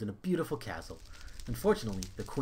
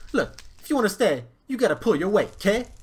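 higher, louder dwarf voice; bossy dwarf; 9 semitones higher, 10 dB louder than usual. Acted. Head dwarf tells the prince the rules, loud. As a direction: lay down the rules loudly.

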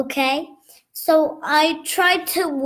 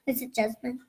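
whisper; 3 semitones lower, 8 dB quieter than usual. Real, not acted. Child whispers a guess back.

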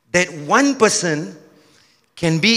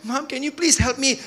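put-on mild crying boy; 7 semitones higher than usual. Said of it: "he was crying".

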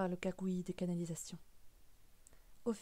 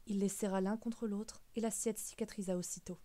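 ordinary delivery, nothing marked

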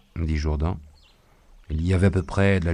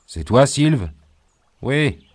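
calling out; 7 semitones higher, 6 dB louder, slower than usual. A narrator's reading. Voices of night encounter.

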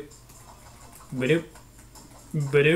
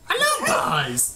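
Jacksepticeye shout; slower than usual. Retold or imitated. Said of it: "In the words of Jacksepticeye".

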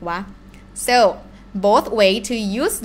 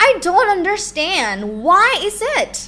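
frustrated; 8 semitones higher than usual. Shown as a demonstration. Translating acted frustration.

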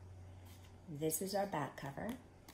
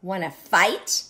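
loud, slow, rising challenge; storybook bully itching for a scrap; grouchy ladybug; 15 dB louder, slower than usual. Acted. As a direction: dare them, stretching the last word. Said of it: "said the grouchy ladybug".

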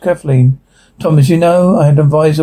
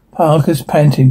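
ordinary talk, nothing marked

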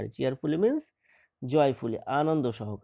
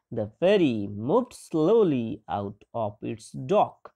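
slow reading aloud; 3 semitones lower, much slower than usual. Shown as a demonstration. Teacher reads English text slowly.

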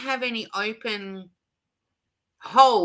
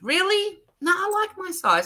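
mock surprised self-question; 11 semitones higher than usual. Real, not acted. Mock question, then answering herself.

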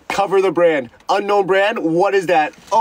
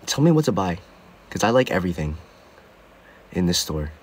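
retold self, lowered; 12 semitones lower, 6 dB quieter than usual. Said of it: "And I go".

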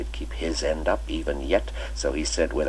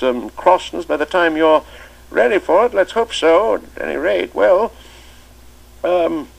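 put-on much higher and louder, playful old schoolmaster; twinkling old master; 13 semitones higher, 9 dB louder than usual. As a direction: cap their joke with a merry invitation to tea. Chips musing to the boy.

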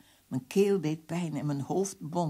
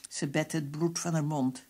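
usual speaking voice

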